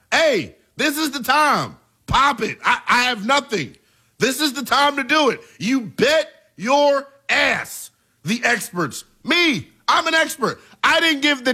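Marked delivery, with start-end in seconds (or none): 0.1-7.9 higher, excited call to pop it; 흥분해서 높은 목소리로 외치기; hyped up. Real, not acted. Excited reaction to viewer comment.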